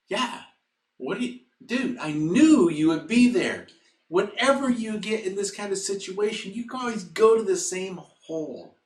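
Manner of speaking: high, animated mock exasperation; exasperated friend. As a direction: loudly chide a friend you know too well. Friend above scolds man in hole.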